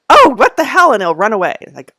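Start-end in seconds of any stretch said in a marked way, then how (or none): 0.1-0.9 high, loud startled cat voice; mock startled cat; voicing the cat. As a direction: play the spooked cat, much higher and louder. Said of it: "he'll look at me and go like".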